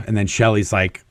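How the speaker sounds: ordinary delivery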